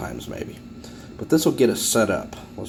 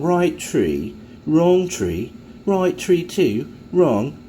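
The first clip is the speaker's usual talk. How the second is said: light mock posh English recital, higher; a hint of a snooty English gent; the snooty guy reels off the poem in mock English poshness; 5 semitones higher, 3 dB louder, much slower than usual. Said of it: "the snooty guy going".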